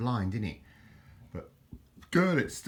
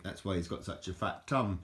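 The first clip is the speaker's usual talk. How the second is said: ordinary talk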